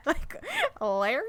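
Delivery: slow, laughing question. Real, not acted. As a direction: giggle through the words. Teasing nickname, laughing.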